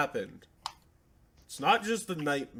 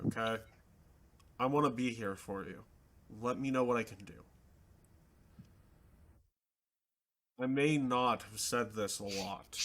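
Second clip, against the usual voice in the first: earnest group leader, easing into a softer reassurance; measured heart-to-heart with weighty pauses, quieter by the end; caring boss; 4 semitones lower, 5 dB quieter, faster than usual. Acted. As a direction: speak steadily and sincerely to a hurting team member. Game master plays Matt reassuring a party member.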